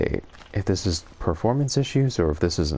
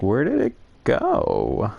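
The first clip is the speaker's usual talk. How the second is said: puzzled quote; 6 semitones higher, 3 dB louder than usual. Retold or imitated. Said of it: "I was like".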